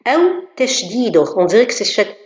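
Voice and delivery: normal talking voice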